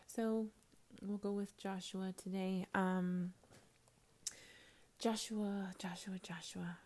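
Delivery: quiet, half-whispered talk; soft hushed sermon-chat. Real, not acted. Talks hushed after break.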